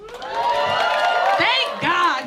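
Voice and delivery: normal talking voice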